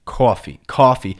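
coffee with an AW vowel; New York "Coffee", first vowel like AW. Shown as a demonstration. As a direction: say "Coffee" as CAW-fee. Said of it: "C A W F E E is how it sounds".

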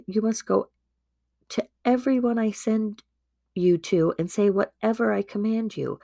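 ordinary delivery

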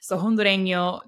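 ordinary talk, nothing marked

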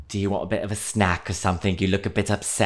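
lightly voiced polite British steward; a hint of a kind British attendant offering a snack; imitating the British flight attendant, mild accent. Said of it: "he was British".

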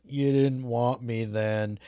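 slow, lightly voiced Kevin Costner drawl; mock the mariner's flat, slow drawl; a hint of Costner, stretched out slowly; imitating Costner's drawl. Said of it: "Kevin Costner's, like, drawly delivery".

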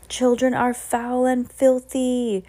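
drawn-out, disgusted proclamation; thundered pronouncement. A narrator's reading. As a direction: thunder it slowly as a witch leader. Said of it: "thundered the high".

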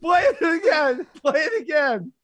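high, laughing and pleading. Real, not acted. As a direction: beg through giggles. Laughing, wants replay.

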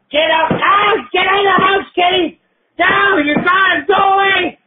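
high, strained shout to drive them out; shooing cats, yelling. Acted. Man ordering his cats out of the house, yelling. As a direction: holler it high at a misbehaving pet.

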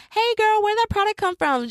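hammed-up excited follower; gushing customer. Voicing customer swiping up.

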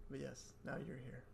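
hushed, creepy host voice. Shown as a demonstration. Host welcoming his cohost in a creepy voice. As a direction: drop to a soft creepy murmur. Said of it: "your creepy voice".

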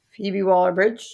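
ordinary talk, nothing marked

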